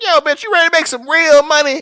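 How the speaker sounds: high, loud hustler voice; slick pimp pitch. Pretend. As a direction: hustle them like a seventies car salesman in a loud suit. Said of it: "seventies car salesman outfit".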